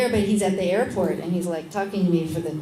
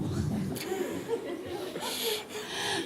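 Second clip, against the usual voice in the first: high, soft breathy laugh at her own punchline; real laugh; 14 semitones higher, 9 dB quieter than usual. Real, not acted. Laughs with the audience at the circumcision twist, high and soft. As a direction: cracks up at the story.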